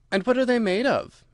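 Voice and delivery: high, curious question; great curiosity. A narrator's reading. Alice asks the Gryphon about shoes, greatly curious. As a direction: ask with pitch lifted in keen interest. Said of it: "in a tone of great curiosity".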